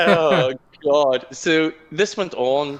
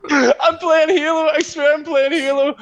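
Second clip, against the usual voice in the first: laughing panic; 14 semitones higher, 5 dB louder than usual. Retold or imitated. Insisting to housemate he was gaming, laughing plea. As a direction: plead through embarrassed laughter. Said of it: "And I was like".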